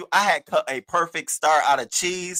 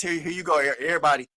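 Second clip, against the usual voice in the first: ordinary talk, nothing marked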